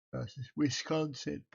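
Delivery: words stretched by a yawn. Real, not acted. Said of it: "Oh, sorry for yawning".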